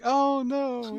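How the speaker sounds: hammed-up distressed owner; mock dismay. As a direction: play the owner's shocked cry. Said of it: "it's just like".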